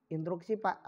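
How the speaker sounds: soft, low-pitched polite request; polite interjection. Shown as a demonstration. Teacher shows pupils how to interrupt politely, soft and low. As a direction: ask the floor courteously, keeping it quiet. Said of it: "kalau yang lebih sopan begini".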